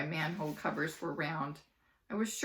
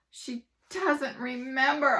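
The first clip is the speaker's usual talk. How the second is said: groaning; 8 semitones higher, 7 dB louder, slower than usual. Acted. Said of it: "I groaned".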